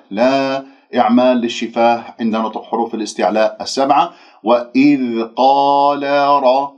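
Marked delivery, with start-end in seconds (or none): 4.4-6.7 slow, heavy را with a full open vowel; heavy ra demo. Shown as a demonstration. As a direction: draw out the heavy را. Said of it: "را مفخمة الراء".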